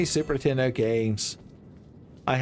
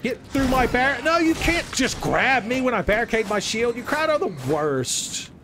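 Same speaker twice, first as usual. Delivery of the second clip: much higher and louder, panicked; frantic shouting; 11 semitones higher, 3 dB louder than usual. Real, not acted. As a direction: yell at the enemies in a panic. Frantic shouting during boss fight.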